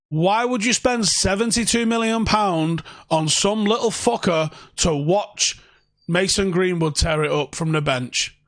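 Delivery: ordinary delivery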